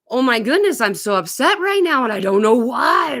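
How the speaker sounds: loud, high-pitched emotional meltdown; upset outburst. Acted. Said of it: "in this emotional place of".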